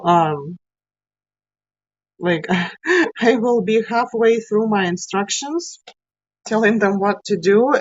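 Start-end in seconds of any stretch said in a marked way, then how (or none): none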